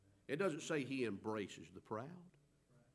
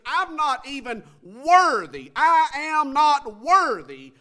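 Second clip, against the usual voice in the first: humble cry; 9 semitones higher, 16 dB louder, slower than usual. Retold or imitated. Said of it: "And he said".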